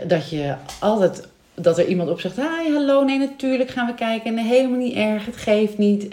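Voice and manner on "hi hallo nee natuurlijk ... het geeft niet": high, sing-out friendly phone greeting, gradually settling; warm welcome. Acted. Model telephonist greets a parent who calls, warmly welcoming. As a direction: act out a receptionist putting the caller at ease. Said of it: "in een soort warm bad ontvangen wordt".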